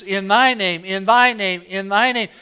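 thy hit hard each time, falling after it; repeated in thy name with thy loud and high; stress on thy. Shown as a demonstration. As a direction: punch thy in every in thy name. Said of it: "Do you see the emphasis?".

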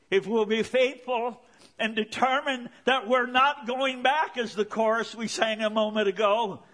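loud, high, driving sermon voice; impassioned preaching. Real, not acted. Preaches at full force.

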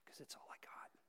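barely holding on, hushed; faint, fragile whisper of faith; desperate whisper. Retold or imitated. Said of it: "you say".

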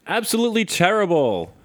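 high, loud mock outrage; indignant review reading. Co-host reading a customer's complaint about chip bags, mock indignant. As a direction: read it out as an appalled customer.

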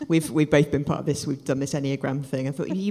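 usual speaking voice

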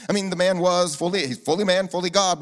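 ordinary delivery

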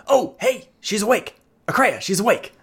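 high, squeaky little kobold voice; a small kobold, thrilled she woke up; excited kobold. Acted.

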